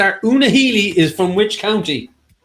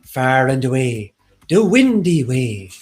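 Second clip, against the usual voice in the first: put-on over the top Irish accent; 3 semitones lower than usual. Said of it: "I'll do my best fake Irish accent".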